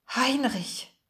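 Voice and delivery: breathed out in a daze; a stunned, faraway utterance of the name; dazed. A narrator's reading. Margarete alone with the letter, dazed. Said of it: "Sie klang benommen".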